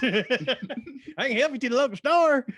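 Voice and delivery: put-on loud, high, drawn-out Southern accent; hollered Southern offer of help; North Georgian accent. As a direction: ham up a slow Georgia accent. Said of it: "that was a North Georgian accent".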